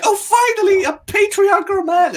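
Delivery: high, swooning falsetto squeal; mock swooning Rey. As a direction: gush like a lovestruck girl in a high voice. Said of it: "she instantly like".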